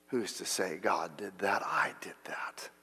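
a proud heart muttering to itself in a hush; secret whisper. Retold or imitated. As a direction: whisper the boast as if no one should hear.

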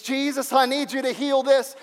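loud, high desperate prayer; crying out to Jesus. Pretend. Believer praying to Jesus, crying out loud. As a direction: plead with him, loud and raw. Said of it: "say to Jesus".

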